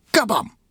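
high, punchy "kabam"; impact exclamation. A narrator's reading. Narrator voicing the ninja kick, high punchy exclamation. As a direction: pop out the crash word high.

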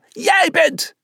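high-pitched bellowed call; urgent, high yell; bellowing. A narrator's reading. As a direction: shout it to a teammate mid-game. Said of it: "bulderde ze".

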